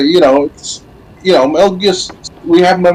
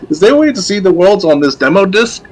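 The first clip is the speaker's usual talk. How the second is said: puzzled younger self; 8 semitones higher than usual. Retold or imitated. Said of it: "It's like".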